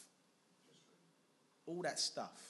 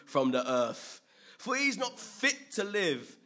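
read mob cry; 3 semitones higher, 8 dB louder, slower than usual. Retold or imitated. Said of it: "raised their voice and said".

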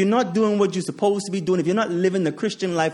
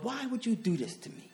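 hurt plea to God; 6 semitones higher, 11 dB quieter than usual. Retold or imitated. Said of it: "and I said to God".

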